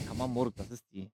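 ordinary talk

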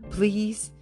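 ordinary talk, nothing marked

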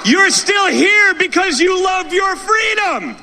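slow, high, shouted rally cry. Real, not acted. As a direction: rouse the crowd, big and slow. Rally speech, building to crowd cheer.

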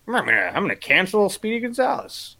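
high, put-on grumbler voice; sputtering mock indignation, pitched high; mock outraged grown-up. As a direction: play a petty adult fussing over cartoons. Mocks an angry adult cancelling cartoons.

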